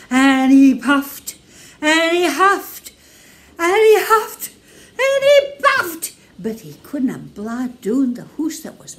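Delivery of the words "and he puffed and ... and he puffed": slow, loud, rising higher and higher; growing huff and puff; building huffing. A narrator's reading.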